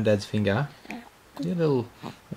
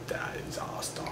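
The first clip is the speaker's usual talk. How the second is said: mock tragic whisper, barely voiced; whispered repeat; 15 dB quieter, much slower than usual. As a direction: breathe it out like a dramatic aside.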